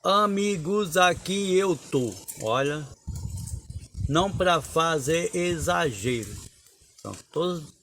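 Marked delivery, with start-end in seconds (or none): 0.0-6.3 higher, slow, ringing recital; poetry recital voice; declaiming cordel verse. Shown as a demonstration. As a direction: announce yourself to the crowd like a cordel poet. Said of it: "vou declamar aqui".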